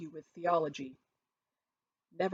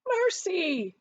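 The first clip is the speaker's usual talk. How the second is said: much higher, louder terrified cry; screaming; 13 semitones higher, 6 dB louder than usual. A narrator's reading. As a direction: shriek for mercy again. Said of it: "he screamed".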